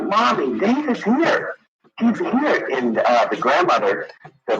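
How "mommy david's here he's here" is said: put-on much higher pleading little-girl voice; imitating a small girl. Caller imitating the little girl telling her mother about David. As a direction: pitch it up high like a small child insisting.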